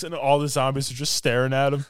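ordinary talk

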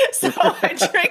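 words broken up by a laugh. Real, not acted. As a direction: laugh your way into the line. Laughing at her own story.

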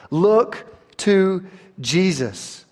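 "Look to Jesus" stretched out slowly; slow refrain. Real, not acted. Preacher closing his point to the congregation, slow. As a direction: let the refrain land slowly. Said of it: "preacher's closing refrain".

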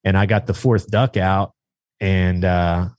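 normal talking voice

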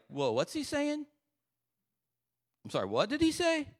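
high-pitched, soft-edged disbelief; incredulous David. Acted. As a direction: question it as if you misheard. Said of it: "David is like".

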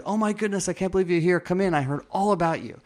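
high, bright burst of delight, imitating her; excited greeting. Operator welcoming the new applicant, imitated excited gush. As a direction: gush like she's been waiting for you. Operator thrilled to meet him.

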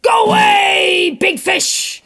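loud, slow brave shout; strong shout. Acted. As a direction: shout the fish away with conviction. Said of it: "with such strength and conviction in his voice".

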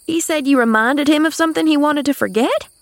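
high, astonished echo with rising end; excited questioning. A narrator's reading. Maid seizing on the girl's remark, excited. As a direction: repeat it as an eager question. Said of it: "interrupted Nancy excitedly".

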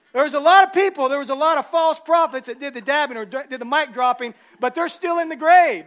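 loud, high shouted preaching; full-force preaching. Acted. Preacher driving home that Jesus delivered, shouting. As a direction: preach it with everything you have.

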